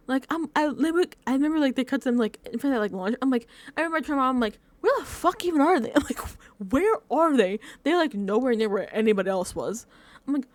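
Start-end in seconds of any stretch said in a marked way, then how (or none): 4.8-7.6 higher, laughing exasperated questions; exasperated disbelief. Retold or imitated. Turning to mom about lost contestants, exasperated. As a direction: demand it in baffled, amused disbelief.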